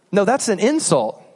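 ordinary delivery

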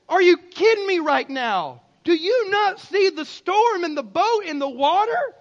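high, loud, outraged disbelief; incredulous yell. Acted. Disciple answering Jesus mid-storm, incredulous yell. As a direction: shout it back in exasperation, drawing out the end.

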